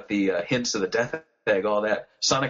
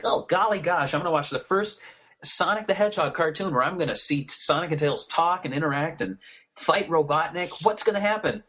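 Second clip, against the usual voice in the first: put-on higher, eager nine-year-old voice; excited kid voice; 7 semitones higher than usual. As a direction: gush like an excited young fan. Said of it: "I'm nine years old, and I'm like".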